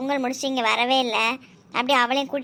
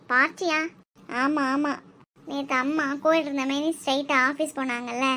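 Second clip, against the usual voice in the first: usual speaking voice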